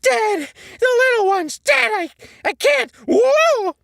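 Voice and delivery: very high, very loud frantic cries; screaming. Acted. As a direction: shout in panic while running. SpongeBob running, screaming.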